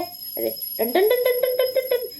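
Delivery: high, soft, slow ding-ding of a prayer bell; sing-song mock bell chime; bell imitation. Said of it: "आपको घंटे की आवाज़ आ रही है".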